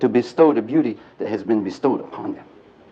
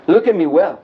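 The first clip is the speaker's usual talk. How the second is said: said out loud; 5 semitones higher, 5 dB louder than usual. Retold or imitated. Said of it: "he says out loud".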